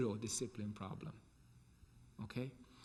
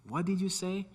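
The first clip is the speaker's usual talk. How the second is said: higher, raised questioning call; calling over noise; 7 semitones higher, 4 dB louder than usual. Retold or imitated. Teacher asking shouting kids once more, high raised call. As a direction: pitch it up over the din.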